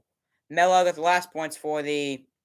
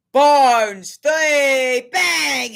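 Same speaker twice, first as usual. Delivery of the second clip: loud, high play-by-play yell; excited shout; 7 semitones higher, 6 dB louder, much slower than usual. Real, not acted. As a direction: shout the made three. Calling a made three.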